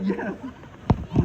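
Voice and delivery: ordinary talk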